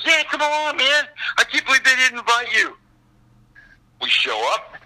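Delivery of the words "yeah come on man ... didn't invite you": higher-pitched buddy egging someone on; indignant agreement. Retold or imitated. Bandmate agrees to give an uninvited friend a ride, indignant. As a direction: eagerly urge him along in a raised pitch.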